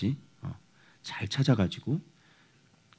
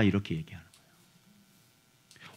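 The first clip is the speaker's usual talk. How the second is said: whisper; 7 semitones lower, 13 dB quieter, slower than usual. Real, not acted. Pastor confides the key term.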